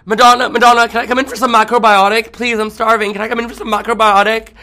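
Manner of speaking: high, loud, begging yell. Retold or imitated. Fan screaming outside [name]'s house, begging to come in. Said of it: "I just scream outside her house".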